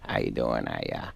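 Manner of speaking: low, mumbled Adam Sandler impression; mumbling Adam Sandler. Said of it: "he just kinda mumbles".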